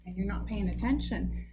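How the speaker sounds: ordinary delivery, nothing marked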